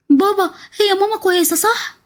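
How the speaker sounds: put-on a scared boy asking his father; anxious child. Frightened son asks his father.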